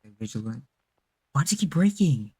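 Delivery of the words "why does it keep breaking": frustrated outburst. Real, not acted. Game glitching, frustrated.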